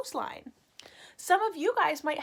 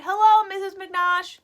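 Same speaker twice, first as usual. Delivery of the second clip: higher, louder phone greeting; caller's voice; 7 semitones higher, 8 dB louder than usual. Acted. Teacher voicing the caller on the phone, a high cheery greeting.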